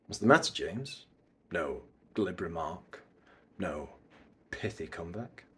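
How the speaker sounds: low, soft villain taunt; hushed, low goading; mysterious bad guy. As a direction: needle an old rival quietly, with pauses. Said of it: "bad guy mysterious vibes".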